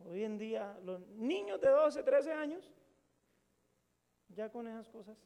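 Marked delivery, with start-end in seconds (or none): 0.8-2.7 lento y fuerte, más alto; énfasis. Real, not acted. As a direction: remarca los doce y trece años. Énfasis en la edad. (recalca la edad con asombro, más fuerte)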